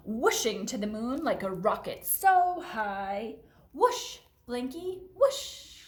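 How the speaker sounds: lively storytime voice; reading to children. Acted. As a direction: read it bouncy for preschoolers. Reads picture book to children.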